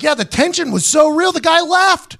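high-pitched mock accusation; retold in a high, incredulous voice; exclaimed accusation. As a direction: pile on, acting out a friend's disbelief. Retold friend's teasing accusation.

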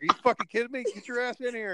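high-pitched mock outrage; retold indignant demand. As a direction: replay an incredulous, exasperated order. Reenacts yelling at driver.

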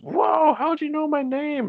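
put-on high, amazed kid voice; little boy voice. Boy amazed the lifeguard knows his name, high kid voice. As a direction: sound like a wide-eyed child.

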